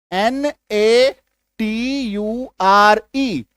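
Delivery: letters spelled out one by one, very slowly; slow spelling. Shown as a demonstration. Teacher spelling out a word for students, very slowly. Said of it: "इसका उच्चारण क्या करते हैं?".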